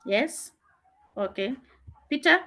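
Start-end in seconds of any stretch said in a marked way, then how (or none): 2.1-2.4 calling out. Real, not acted. Calling on a student.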